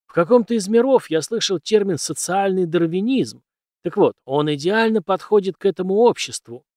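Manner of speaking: loud, emphatic mentor lecturing a naive pupil; forceful, declaiming teacher figure; cynical old teacher. A narrator's reading. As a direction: hold forth loudly, with scornful rhetorical questions. Teacher voiced in narrated story.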